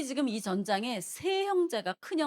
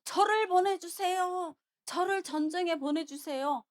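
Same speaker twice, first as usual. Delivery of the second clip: David's attention-seeking clamour; 7 semitones higher, 3 dB louder than usual. Retold or imitated. David making a commotion for the king to notice, clamouring. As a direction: call out so the whole camp hears. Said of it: "이러면서 막 소란을 피우는 거예요".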